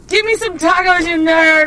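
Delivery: loud high yelled demand; mock yell. Drunk friend yelling at the drive-thru window for tacos. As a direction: bellow it like a drunk in the back seat.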